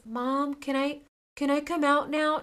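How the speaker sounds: a hint of a child begging mom; son pleading. Son's quote, begging to leave room.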